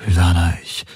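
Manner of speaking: ordinary delivery, nothing marked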